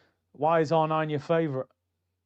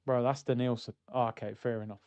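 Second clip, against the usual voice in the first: deadpan deflation; 6 semitones lower, 7 dB quieter than usual. Pretend. Deflating punchline, friend and reply.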